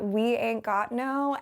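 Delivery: slow Southern drawl on "We ain't got no"; Southern accent. Retold or imitated. Southern accent repeated to herself, slowly. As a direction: lean into the Southern twang, slowly. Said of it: "I said something like".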